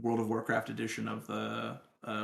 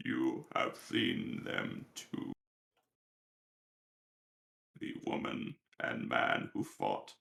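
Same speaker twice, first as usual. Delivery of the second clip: deep, slow whispered growl; low breathy NPC whisper, drawn out; Sledge voice; 13 semitones lower, 4 dB quieter, slower than usual. Acted. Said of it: "you can hear Sledge from the corner by Jurgen".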